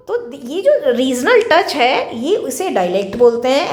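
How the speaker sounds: ordinary talk, nothing marked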